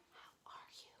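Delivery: soft whispered echo of the line. Shown as a demonstration. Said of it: "like an echo".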